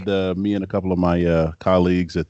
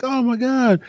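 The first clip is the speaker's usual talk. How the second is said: high, loud thrilled shout; excited greeting; 4 dB louder than usual. Retold or imitated. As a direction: greet an old friend with a joyful yell. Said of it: "and he's like".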